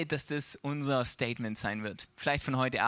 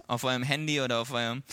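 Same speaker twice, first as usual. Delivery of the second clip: normal talking voice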